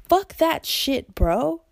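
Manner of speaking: slow, high angry outburst. Real, not acted. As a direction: spit it out furious.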